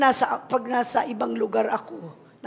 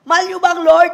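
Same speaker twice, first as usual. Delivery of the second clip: preaching shout; 7 semitones higher, 9 dB louder, faster than usual. Real, not acted. Calling out to congregation.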